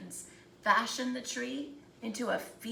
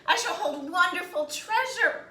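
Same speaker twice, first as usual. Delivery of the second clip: higher and louder, glowing with hope; eager hope; 7 semitones higher, 7 dB louder than usual. Acted. First tree after falling, eager and higher. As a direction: let the hope lift the line. Felled tree still hopeful.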